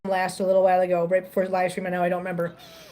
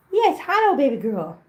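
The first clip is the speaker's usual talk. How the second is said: cooing hello; 3 semitones higher than usual. Real, not acted. Greeting a cat.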